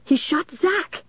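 high, slow cry rising on Zach; high-pitched, stretched-out accusation; insistent accusing cry. A narrator's reading. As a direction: cry it out high, lifting the last word. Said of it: "Dennis repeated".

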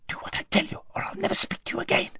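quick, angry whisper; fierce hushed threat; hissed threat. A narrator's reading. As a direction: hiss a warning under the breath. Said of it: "hissed Aravis".

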